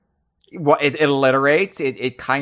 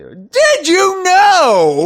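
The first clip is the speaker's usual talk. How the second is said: drawn-out, very high, booming shout; even louder announcement; 10 dB louder, much slower than usual. Pretend. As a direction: bellow it slowly, stretching every word. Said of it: "say that loud enough".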